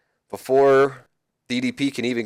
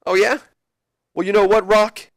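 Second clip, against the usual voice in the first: high, louder indignant retort; indignant comeback; 9 semitones higher, 4 dB louder than usual. Retold or imitated. Jericho insulted by The Rock, loud and high. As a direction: snap back, offended.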